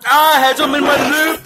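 fast, loud, high pained voice; pained and rushed. Real, not acted. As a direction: blurt it out in pain. Mouth burning from hot wings.

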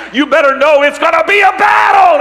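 high, loud shout landing hard on "battle"; thundering preacher's shout; shouted warning. Acted. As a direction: belt "battle" at the congregation.

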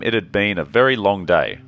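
normal talking voice

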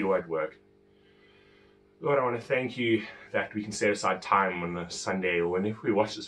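low, soft, even praying voice; subdued, earnest congregational prayer; opening prayer. Real, not acted. Opens with a prayer.

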